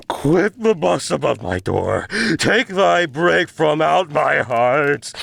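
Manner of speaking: high, forceful command; raging plea. Acted. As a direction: order the bird off the door. Raging at the raven.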